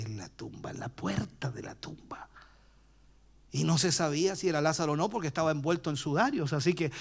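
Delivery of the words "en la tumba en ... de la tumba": narración susurrada, tensa; susurro de suspenso. A narrator's reading. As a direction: baja a un susurro para el suspenso. Aparece la silueta. (suspenso susurrado al aparecer Lázaro)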